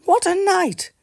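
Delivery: very high little mole voice; small mole marvelling at the storm; mole mutters. Acted. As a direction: exclaim it like a tiny excited mole. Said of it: "Mole mutters".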